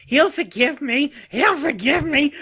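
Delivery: high, drawn-out hopeful cry; wailing mock plea. Luther in his stasis cell begging forgiveness, mock wailing. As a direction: wail it in a high, stretched-out put-on voice.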